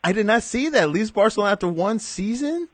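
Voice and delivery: high-pitched disbelief; incredulous, voice shooting way up; real surprise. Real, not acted. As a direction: react to news you can't believe. Reacts to surprise transfer news.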